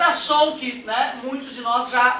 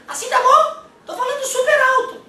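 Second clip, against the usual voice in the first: squeaky falsetto, much higher and louder, with a laugh; high-pitched voice; 13 semitones higher, 6 dB louder than usual. Shown as a demonstration. Teacher demoing a high voice to the class, squeaky falsetto. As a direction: jump way up into a shrill mock voice to show what high means. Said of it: "o cara pode começar a falar assim".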